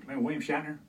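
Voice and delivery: ordinary talk, nothing marked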